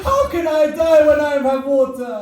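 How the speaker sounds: high, loud lamenting protest; anguished outcry. Acted. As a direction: cry it out in impassioned grief. Imam cries out against offered water.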